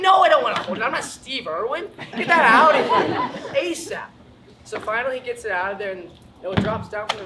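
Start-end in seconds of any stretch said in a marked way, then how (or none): 0.0-4.0 high, loud frantic no; loud refusal. Retold or imitated. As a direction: shout it off like the snake is coming at you. Refusing to hold the snake.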